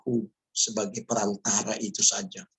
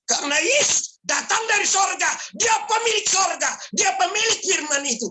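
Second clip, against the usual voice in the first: high, loud preaching shout; fiery preaching; 5 dB louder than usual. Acted. Preacher proclaiming to the congregation, full-force shouting sermon. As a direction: thunder it at the congregation.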